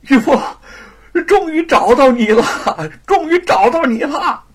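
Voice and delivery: high, loud overjoyed cry of her name; calling out with joy at finding her; excited. A narrator's reading. Scholar finally finds his lost wife, overjoyed and loud. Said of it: "兴奋地说".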